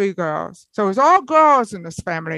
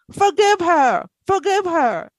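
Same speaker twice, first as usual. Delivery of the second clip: half-shouted plea, pitched up; retold scream; 8 semitones higher than usual. Father's voice in her head urging forgiveness, raised cry. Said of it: "I felt my father screaming in my head".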